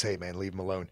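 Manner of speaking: a quiet, uneasy hey man, leave him alone from a nervous friend; quiet nervous friend trying to calm a bully; nervous plea. Retold or imitated. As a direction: softly ask him to back off, a little scared. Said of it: "Kevin was nervous".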